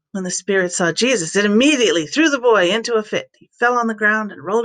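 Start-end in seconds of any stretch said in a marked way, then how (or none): none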